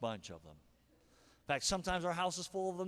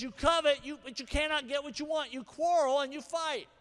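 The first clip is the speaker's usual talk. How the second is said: forceful quote; 8 semitones higher, 6 dB louder than usual. Acted. Preacher quoting James forcefully.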